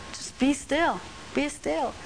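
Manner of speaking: calm the room with it; hushed call to stillness; soft repetition. Shown as a demonstration. Softly repeating the psalm.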